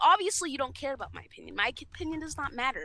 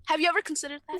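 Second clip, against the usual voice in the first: higher, voiced, needling question; pointed sarcasm; 5 semitones higher, faster than usual. Speaker taunting the friend she complains about, pointedly sarcastic. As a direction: ask it openly as a taunt. Repeats the comeback, now pointedly.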